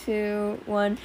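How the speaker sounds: normal talking voice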